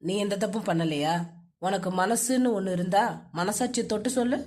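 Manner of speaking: low, bitter man's voice; low-pitched, reproachful husband; accusing husband. Acted.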